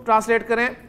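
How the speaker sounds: ordinary talk, nothing marked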